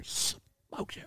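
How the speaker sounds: hushed whisper of admiration; conspiratorial whisper. Shown as a demonstration. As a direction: whisper it like a secret. Repeats phrase, whispered.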